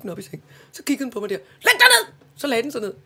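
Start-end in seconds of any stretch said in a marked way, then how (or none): 1.4-2.1 high, loud order to the dog; stern command. Retold or imitated. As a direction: command the dog back to bed. (høj, streng kommando)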